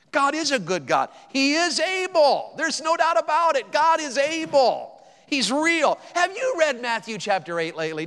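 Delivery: preaching at full force. Acted. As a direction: drive home God is able to the church. Builds into preaching.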